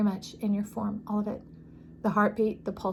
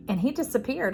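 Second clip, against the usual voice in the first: normal talking voice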